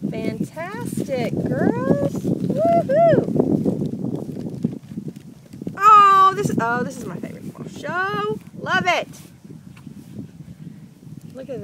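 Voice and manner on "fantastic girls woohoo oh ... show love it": high, loud cheer with a whoop. Real, not acted. Mom cheering the crafters, high and loud.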